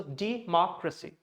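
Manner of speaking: democracy as "dee, MOCK-ruh-see"; democracy with clear second syllable and reduced ending; model pronunciation. Shown as a demonstration. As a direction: say democracy with MOCK set apart and stressed. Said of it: "This is how we have to pronounce".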